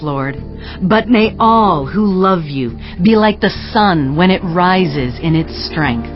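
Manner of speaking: ordinary talk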